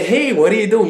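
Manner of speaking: perky wrong-way demo; mock eager. Shown as a demonstration. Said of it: "like, like, you know, say".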